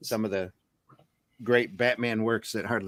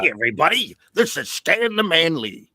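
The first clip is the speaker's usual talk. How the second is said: raised showman voice, slowed; Stan Lee; 5 semitones higher, 3 dB louder than usual. Retold or imitated. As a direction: bombastic comic host intro. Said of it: "trying to imitate Stan Lee".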